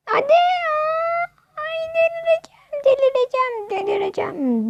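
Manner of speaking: high, cracking whine that fades; despairing cry at the missed goal; frustrated wail. Real, not acted. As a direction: wail it like you're losing your mind. Frustrated gamer losing chance.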